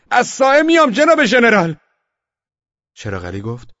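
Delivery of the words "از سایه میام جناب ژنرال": very high, full-voiced yell; shouted reply. A narrator's reading. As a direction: yell back desperately loud to the general. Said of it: "منم داد زدم". (داد زدن در جواب)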